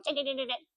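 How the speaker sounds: quick, soft staccato ticking; light rapid-fire clicking noise; squirrel chatter. Retold or imitated. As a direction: imitate a tiny chattering squirrel. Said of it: "you can hear the little thing going".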